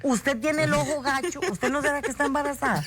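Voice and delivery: mother telling daughter she looks pregnant, lightly imitated; mother's knowing remark. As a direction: lightly voice mom's sly observation. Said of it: "eso me dijo mami".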